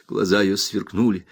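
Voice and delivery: ordinary delivery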